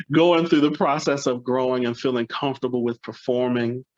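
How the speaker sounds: ordinary talk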